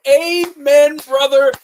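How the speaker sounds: loud, high joyful amen, calling out; hearty amen. Real, not acted. Laughing host cheers the Frenchman quote, loud high amen. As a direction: shout your approval like a church response.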